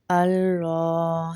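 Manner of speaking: اللہ with a thick, full lam, slowly; drawn-out اللہ with the lam made heavy. Shown as a demonstration. Teacher demonstrates the heavy lam for students. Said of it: "لام کو موٹا کریں گے".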